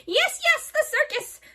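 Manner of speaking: high gleeful yes yes; excited witch. A narrator's reading. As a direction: bounce with joy. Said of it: "she shouted".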